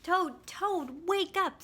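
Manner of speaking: loud high wake-up call. A narrator's reading. Frog wakes sleeping Toad.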